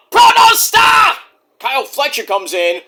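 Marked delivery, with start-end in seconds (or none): 0.1-1.1 high, loud, drawn-out nickname; announcer shout. Pretend. Host announcing the wrestler's entrance, drawn-out shout. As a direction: belt it out slowly like a ring announcer.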